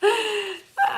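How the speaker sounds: short high laugh. Real, not acted. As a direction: burst of high-pitched laughter. Laughs before saying oh boy.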